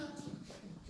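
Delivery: a quick retold gasp; sharp gasp. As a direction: gasp in mock shock at the game. Gasp retelling Jeff watching football.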